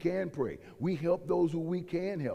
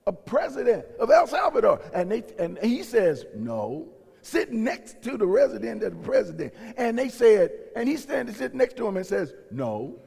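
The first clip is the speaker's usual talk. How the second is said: loud, rising preacher's fervor; preaching at full force; 5 semitones higher, 7 dB louder than usual. Acted. Pastor rousing his church, in a loud fervent preach. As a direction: drive it home to the congregation.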